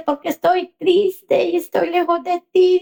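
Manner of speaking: high plaintive confession; tearful child. Retold or imitated. Crying daughter far from her mother, sorrowful. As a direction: imitate a crying child missing a parent. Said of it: "¿y por qué estás llorando?".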